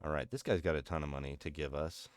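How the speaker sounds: ordinary talk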